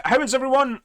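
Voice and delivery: ordinary talk, nothing marked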